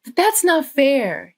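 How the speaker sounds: put-on high, loud, slow childish protest. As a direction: whine it like a kid who feels cheated. Child protesting to his dad.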